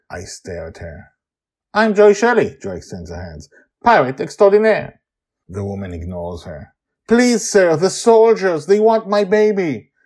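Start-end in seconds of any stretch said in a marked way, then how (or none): 1.7-2.6 high, loud proclamation; bold introduction. Acted. Joy offers her hand to the stranger, proudly proclaiming. 3.8-5.0 high, loud grand title; boastful flourish. Acted. Joy shows off to the newly arrived woman, boasting. 7.1-9.9 high, loud, frantic plea; desperate pleading. Acted. Mother with baby begs Grandpa Walt's help, pleading.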